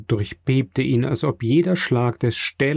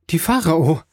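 higher-pitched announcing call; excited cry; 5 semitones higher, 3 dB louder than usual. A narrator's reading. Emmanuel bursting in with news, excited cry. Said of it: "rief er".

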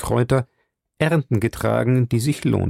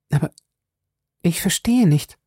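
high, bewildered woman's voice; confused young woman; 7 semitones higher than usual. Acted. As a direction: admit she is lost. Violet verwirrt. (verwirrte junge Frau, hohe Stimme)

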